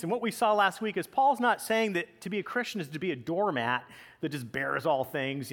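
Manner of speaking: ordinary talk, nothing marked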